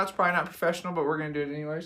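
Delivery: ordinary talk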